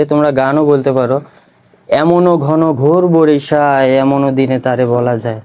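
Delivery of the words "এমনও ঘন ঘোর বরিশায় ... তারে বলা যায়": slow, low recital of verse; poem recitation. Shown as a demonstration. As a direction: draw out the lines like a poem. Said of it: "Rabindranath ঠাকুরের একটা কবিতা আছে". (ধীর লয়ে কবিতা আবৃত্তি)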